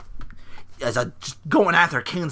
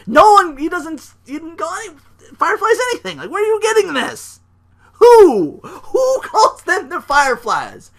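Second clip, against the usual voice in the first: exasperated rant; 11 semitones higher, 7 dB louder than usual. Real, not acted. Addressing internet fans, high exasperated rant. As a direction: rant in disbelief. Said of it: "rant at internet fans".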